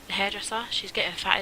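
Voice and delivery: ordinary talk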